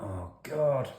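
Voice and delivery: high groan of embarrassment; cringing. Retold or imitated. Remembering the awkward handshake, cringing. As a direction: wince at the memory. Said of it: "I'm like".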